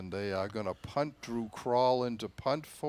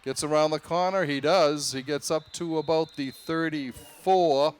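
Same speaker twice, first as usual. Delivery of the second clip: louder, faster, rising call as the runner breaks free; excited play call; 4 semitones higher, 6 dB louder than usual. Real, not acted. Announcer calling a long run to the listeners, excited and louder. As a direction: sell the run as it happens, quick and loud.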